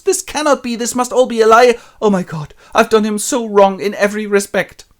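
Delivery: high, loud distraught cry; horrified remorse. Retold or imitated. Lizzie horrified after the letter, high and loud. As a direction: panic at your mistake. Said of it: "And she goes".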